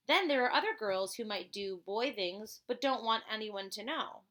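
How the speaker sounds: usual speaking voice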